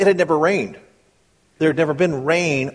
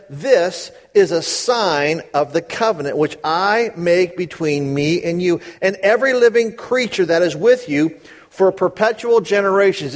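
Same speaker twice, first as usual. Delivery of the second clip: raised, measured Bible reading; scripture reading; 4 semitones higher than usual. Acted. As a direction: read the verses out with weight. Reads scripture aloud.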